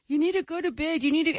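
mock a little girl ordering you to bed; bossy toddler. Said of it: "when she's like".

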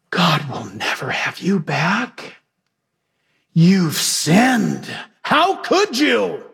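a hint of a slow, mocking accuser; lightly voiced devil twisting the knife; accusing tempter. Satan condemning the sinner he tempted, in lightly mocking accusation. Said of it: "in that seductive voice turns right around and says".

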